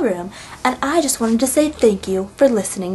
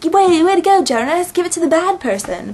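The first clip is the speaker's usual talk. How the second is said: high-pitched mocking congratulations; sarcastic scolding; 7 semitones higher than usual. Real, not acted. Viewer scolding Jonas for helping the bad person, sarcastic. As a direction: chide him with exaggerated false praise.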